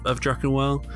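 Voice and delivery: usual speaking voice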